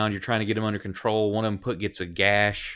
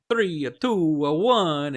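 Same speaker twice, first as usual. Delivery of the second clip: a thick Italian photographer, higher and louder; heavy Italian accent; 7 semitones higher, 5 dB louder, slower than usual. Acted. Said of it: "in a heavy Italian accent".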